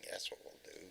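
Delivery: sly whispered aside; whispering. Acted. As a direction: whisper it like a secret plan. Conspiratorial aside about forming gang.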